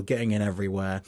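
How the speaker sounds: ordinary talk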